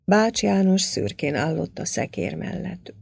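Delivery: ordinary delivery, nothing marked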